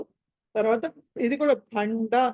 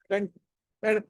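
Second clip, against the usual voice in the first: usual speaking voice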